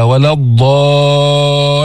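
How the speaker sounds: slow, drawn-out chanted ولا الضالين; Quran recitation. Shown as a demonstration. Teacher quoting the verse in slow chant. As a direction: stretch the madd of الضالين. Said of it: "قوله تعالى".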